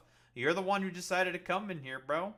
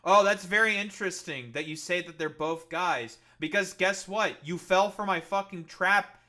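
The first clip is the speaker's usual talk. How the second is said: gloating; 2 semitones higher, 8 dB louder than usual. Real, not acted. Gloating after trapping chatter.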